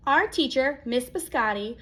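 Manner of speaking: ordinary delivery, nothing marked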